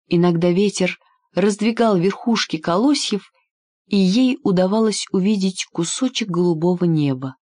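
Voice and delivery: plain reading voice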